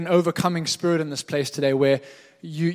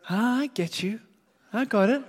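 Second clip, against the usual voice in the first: lightly voiced man, higher, pitched up; mimicking the man; 6 semitones higher than usual. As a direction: play the confused stranger, a bit higher.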